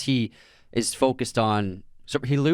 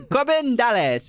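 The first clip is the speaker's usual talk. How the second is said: Chris Tucker voice; 12 semitones higher, 4 dB louder, slower than usual. Retold or imitated. Quoting Fifth Element character.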